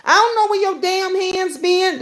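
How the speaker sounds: high-pitched cussing-out, retold with relish; mother cursing someone out. Daughter mimics her mother telling people not to touch her, high-pitched scolding. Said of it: "she would curse your ass out in a heartbeat".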